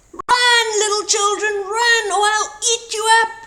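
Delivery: high, loud, fast playful roar; wolf playfully roaring at children; mock threat. A narrator's reading. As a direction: boom it out to make them squeal. Wolf's mock scare game.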